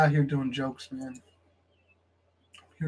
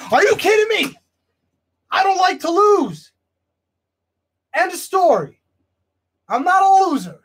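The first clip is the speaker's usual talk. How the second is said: much higher, loud angry yelling; furious shout; 13 semitones higher, 9 dB louder than usual. Acted. Wrestler screaming at promoter that he hates losing, furious shout. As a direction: explode at the promoter.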